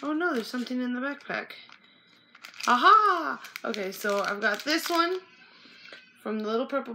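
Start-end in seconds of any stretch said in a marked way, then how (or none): 2.7-3.4 loud, high discovery shout; delighted aha; triumphant aha. Real, not acted. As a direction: pounce on the find. Finds item in backpack.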